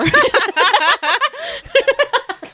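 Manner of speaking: long, high laughing fit; real high-pitched giggling fit; helpless laughter. Real, not acted. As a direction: break up completely in high laughter. Laughing at eating her hair.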